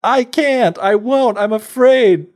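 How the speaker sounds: put-on high, loud, panicky little-boy voice; boy terrified of a talking whale; frightened boy. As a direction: squeal it out in fear. Sven refusing the whale.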